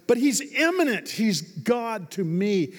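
high, loud sermon burst; impassioned preaching. Real, not acted. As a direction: preach it with rising force. Preaches at full force.

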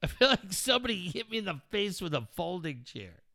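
groggy and dazed; dizzy. Real, not acted. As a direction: speak as if just hit. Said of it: "I'm so dizzy right now".

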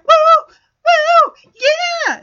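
very high, loud celebratory whoops; elated whooping. Acted. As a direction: whoop it up mid happy dance. Charlie Brown's happy dance.

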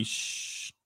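soft, drawn-out shush; mock secretive shh; hushing. Spouse hiding empty college fund, hushing.

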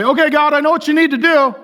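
mock know-it-all prayer to God; bossy prayer. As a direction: order God around, cocky. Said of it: "We don't go to God and say".

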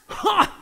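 sharp, loud scoffing snort; defiant snort. Retold or imitated. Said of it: "he snorts".

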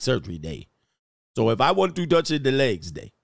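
fake African accent. Doctor and Uber driver scolding a customer, fake African accent. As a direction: put on a clumsy African accent, drifting toward Trini. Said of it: "I sound like a fake Trini man, not an African".

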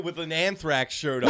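ordinary talk

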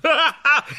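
delighted squeaky laugh; high giggle. Acted. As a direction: giggle at your own rhyme. Giggling at own rhyme.

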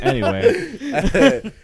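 high, slow, laughing "anyway". Real, not acted. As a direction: laugh it out, drawing it long. Laughs off the biopic leak.

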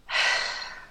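long breathy sigh; weary exhale. Real, not acted. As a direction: let out a heavy sigh. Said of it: "What was that sigh for?".

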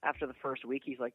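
ordinary delivery

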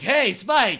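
loud, high, friendly call; calling out happily to a friend; excited greeting. Retold or imitated. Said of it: "were the ones who were like".

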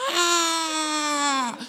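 high mock wailing cry of a hurt child; hammed-up child's wail, half laughing; a little boy wailing in the night. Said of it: "not crying kind of like wailing".

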